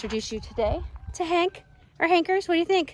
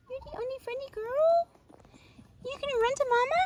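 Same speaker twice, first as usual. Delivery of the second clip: cooing pet talk; 11 semitones higher, 3 dB quieter than usual. Real, not acted. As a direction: coo sweetly in a high voice. Cooing at an approaching sheep.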